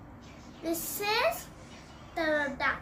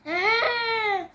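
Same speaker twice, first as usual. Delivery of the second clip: higher, loud cry-out; a sudden whimpering ah; playful mock cry; pretend cry; 5 semitones higher, 6 dB louder than usual.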